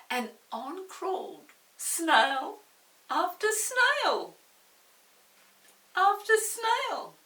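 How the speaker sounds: building wonder. A narrator's reading. Narrator building the climax of the snails' voyage, slow rising wonder. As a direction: stretch each snail out, climbing.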